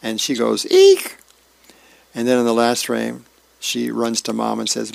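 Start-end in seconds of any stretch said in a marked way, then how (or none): 0.7-1.2 sudden, much higher, much louder shriek of horror. Retold or imitated. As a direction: let out a piercing little scream at the mirror. Girl sees her hair top knot.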